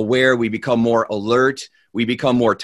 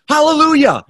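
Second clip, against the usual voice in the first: very high, loud shouted word; desperate shout; 7 dB louder than usual. Acted. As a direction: blurt it out in desperation. He remembers the stop word.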